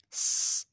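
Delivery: "س" as a bare sustained hiss; whistling s. Shown as a demonstration. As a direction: whistle a long "س" with no vowel. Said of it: "سیٹی کی آواز آئے گی".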